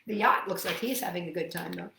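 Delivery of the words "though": ordinary delivery, nothing marked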